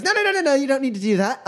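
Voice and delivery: very high hurried protest; head them off in a high voice; placating trickster. Acted. Magician stopping customer from telling on him, high placating. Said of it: "he goes".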